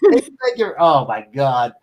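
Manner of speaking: ordinary delivery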